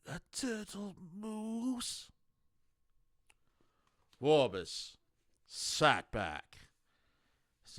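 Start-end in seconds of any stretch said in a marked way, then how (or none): 0.1-2.1 high, slow, puzzled voice; a figure asks in slow bewilderment; puzzled question. A narrator's reading. Minor character asks Vorbis, high and slowly puzzled.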